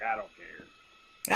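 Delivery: apathetic. Retold or imitated. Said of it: "your give a fuck was just so broke".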